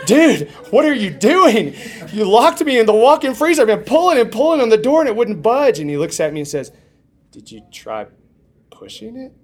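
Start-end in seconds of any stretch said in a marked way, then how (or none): 0.1-5.8 loud, high exasperated protest; indignant outburst. Retold or imitated. Trapped man confronts the guy who locked him in, indignant. 7.3-9.3 soft, understated question; quiet deadpan reply. Retold or imitated. The guy answers the shouting man, quiet deadpan.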